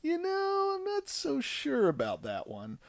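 very high drawn-out well; high awkward uh-well; awkward hesitation. Retold or imitated. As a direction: stall awkwardly with a laugh. Awkward reaction to son.

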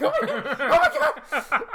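hammed-up terrified wail; panicked crying. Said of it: "I'm, like, crying".

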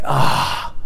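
breathed-out sigh of relief; contented exhale; relieved sigh. Retold or imitated. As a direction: let out a soft, voiceless "ah". Relief at bathing in Word.

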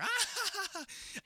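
put-on very high cackle of kids in stitches; imitated hysterical laughter. Imitating a roomful of kids laughing, shrill hysterics. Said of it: "doubled over with laughter".

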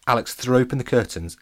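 ordinary delivery, nothing marked

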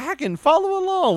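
high, eager mimic voice; mock imitation. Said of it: "He was like".

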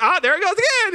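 very high and loud, cheerfully unbothered; easygoing friend shrugging off a mistake; amused patient friend. Retold or imitated. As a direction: laugh it off as the nail drops. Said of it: "He was just always like".

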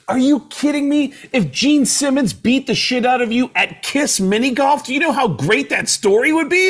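high-pitched, incredulous outburst; excited disbelief. Retold or imitated. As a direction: act out a buddy thrilled by the idea. Buddy reacts in disbelief, excited.

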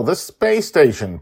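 usual speaking voice

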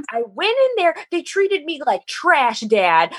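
high-pitched, indignant rant at dad; retold angry shouting. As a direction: re-enact yelling your outrage to your father. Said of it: "I'm sorry to be shouting at you".

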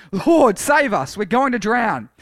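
high, loud plea; shouting. A narrator's reading. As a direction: call out urgently to be saved.

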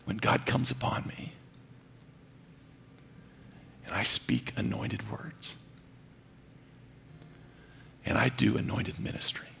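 a reverent whispered testimony; intimate whisper. Real, not acted. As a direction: draw the church in close with a hush.

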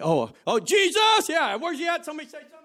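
high, loud put-on voice, flippant; mock careless caller. Preacher mocking a flippant believer, shouting up high. Mocking casual name-calling.

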